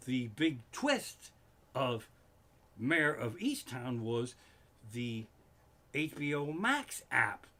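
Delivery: normal talking voice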